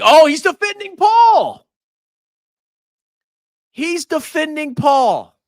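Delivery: high-pitched, exaggerated yell; mock outraged shout. Streamer mocking a viewer for defending Paul, in a high shout. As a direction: cry out in put-on outrage, far above normal pitch.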